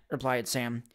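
usual speaking voice

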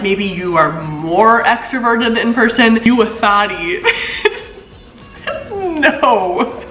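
usual speaking voice

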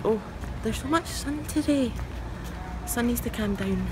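usual speaking voice

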